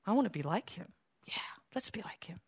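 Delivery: hushed whisper of resolve; whispered admiration. Retold or imitated. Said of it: "the people around you are like".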